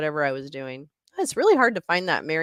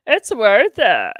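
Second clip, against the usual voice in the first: slow, louder, savoring "It's worth it"; dramatic emphasis; 3 semitones higher, 7 dB louder, slower than usual. Shown as a demonstration. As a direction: land "It's worth it" like a slogan.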